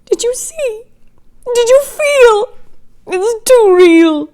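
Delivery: high and shaken, slowing down; wife shaken after the nursery scare; frightened, pleading. Acted. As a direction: plead in fear, drawing it out.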